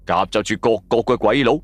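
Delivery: ordinary delivery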